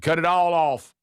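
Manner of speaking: high loud urgent command; urgent mock order. Teller orders everything off in the storm, high and loud.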